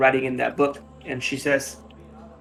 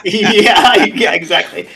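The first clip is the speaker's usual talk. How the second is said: laughing yeah; 7 semitones higher, 11 dB louder, slower than usual. Real, not acted. Laughing agreement at table.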